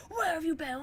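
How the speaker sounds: a mild mock yell of where have you been; mock angry yell. Mom yelling at the waitress, mild mock yell. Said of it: "screaming at the fucking waitress".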